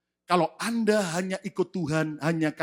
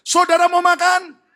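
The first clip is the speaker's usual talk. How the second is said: repeated louder; 12 semitones higher, 11 dB louder than usual. Shown as a demonstration. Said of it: "Saya ulang lagi pertanyaan saya".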